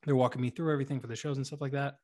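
usual speaking voice

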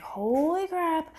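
retold shock, high and slow; drawn-out, hushed alarm; freaking out. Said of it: "like, freaking out".

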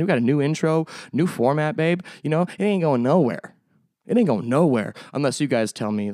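normal talking voice

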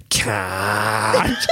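retold shout, one word stretched long; drawn-out mock shout. Friend coaching a shy teenager to say it, drawn-out mock shout.

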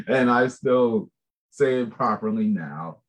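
ordinary delivery

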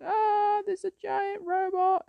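high falsetto mock panic; mock scream. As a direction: squeal like a frightened lady. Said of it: "they started screaming".

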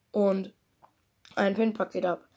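ordinary delivery

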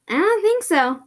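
high, loud pushback; dismissive refusal. Acted. Answering the creepy voice's offer, high dismissive refusal.

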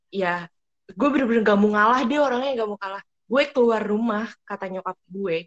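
ordinary talk

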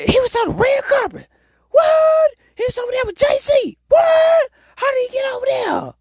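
very high squeaky mock astonishment; imitated shock in a piercing squeak. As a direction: squeal it like a jealous onlooker. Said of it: "Sorry for my squeak voice".

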